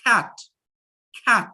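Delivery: cat said slowly and distinctly as one beat; clear demo word. Shown as a demonstration. Teacher counting the syllables in cat for students, crisp demo. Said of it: "I will just pronounce the word".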